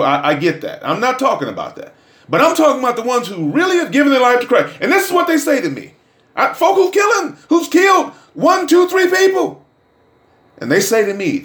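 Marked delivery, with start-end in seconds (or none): 2.3-9.6 building preacher excitement. Acted.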